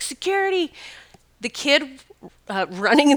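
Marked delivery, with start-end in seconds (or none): 0.0-0.7 high, far-carrying call; retold scream. Woman keeps calling for security, high shout. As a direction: yell for security again. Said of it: "So I started screaming".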